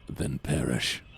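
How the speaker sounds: low, flat deadpan verdict; cold deep dismissal. Acted. Obama refusing the plea, low and cold. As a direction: coldly tell them to perish.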